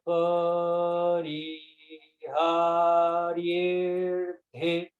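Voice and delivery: pariharyerthe stretched very slowly, syllable by syllable; very slow syllable-by-syllable demo. Shown as a demonstration. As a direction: draw out pa-ri-har-yer-the very slowly. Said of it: "leiam sílaba por sílaba".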